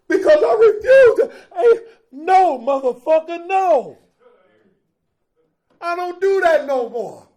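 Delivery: high, loud half-sob half-laugh; laugh-crying. Real, not acted. Speaker overcome telling the meeting he refuses dope, laugh-crying. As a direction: let the tears and joy break through.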